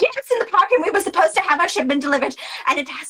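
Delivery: high, fast whining complaint; high rushed whine; shrill Celia. Acted. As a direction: rush it out high and aggrieved. Celia escalates her complaint.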